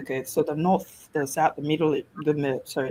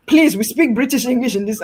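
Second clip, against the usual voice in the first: a lightly put-on proper British voice, a bit higher and louder; prim British English request; 5 semitones higher, 5 dB louder than usual. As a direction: mimic the colleague's stiff British English. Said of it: "one of them even came to me and said".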